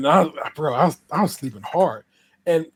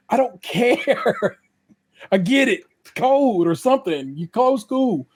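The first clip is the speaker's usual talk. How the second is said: exasperated retort; 7 semitones higher, slower than usual. Retold or imitated. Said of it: "I'm like".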